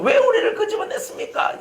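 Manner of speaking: high-pitched imitation of a grumbling crowd; mock resentful wail. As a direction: cry out the complaint in a put-on high voice. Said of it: "이렇게 원망하는 거예요".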